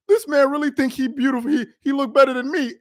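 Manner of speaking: mock a wounded ego, voice wobbling up high; mock hurt voice. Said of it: "his voice is cracking 'cause he hurt".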